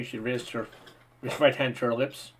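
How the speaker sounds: ordinary delivery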